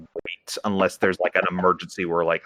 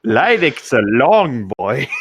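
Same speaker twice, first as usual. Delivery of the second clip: slow, laughing through the words; 3 semitones higher, 3 dB louder, much slower than usual. Real, not acted. Co-host laughing at how tall his friend is. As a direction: chuckle it out slowly, amused.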